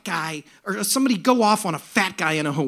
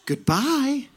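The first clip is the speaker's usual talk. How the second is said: sweet angel's singsong, lifting higher; angelic, joyful; 10 semitones higher, much slower than usual. Retold or imitated. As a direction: chant it like a delighted angel greeting riders. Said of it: "the voice of an angel".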